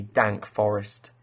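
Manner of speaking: plain reading voice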